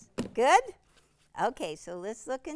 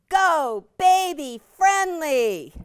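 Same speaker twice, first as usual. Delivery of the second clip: slow, loud, drawn-out name; announcer emphasis; 7 semitones higher, 17 dB louder, slower than usual. Real, not acted. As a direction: announce it like a product launch.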